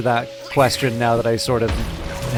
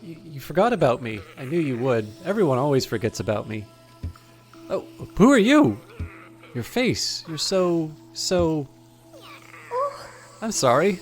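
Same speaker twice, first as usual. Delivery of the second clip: lightly voiced lonely rescued girl; a hint of a shy, pleading young woman; timid girl voice; 3 semitones higher than usual. Reads Bretta's lines in character.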